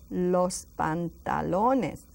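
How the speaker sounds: Los pantalones, very slow and drawn out; slow model word. Shown as a demonstration. Teacher slowly modelling a long word: los pantalones. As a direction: spell it out slowly for learners. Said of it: "That's a very long one".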